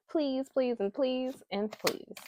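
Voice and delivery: real loud plea repeated; loud pleading. Real, not acted. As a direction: beg them, really plead. Pleading for men to cry.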